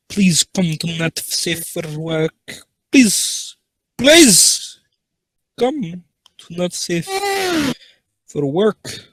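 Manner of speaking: high, drawn-out begging in a put-on voice; hammed-up pleading, loud on each please; mock pleading.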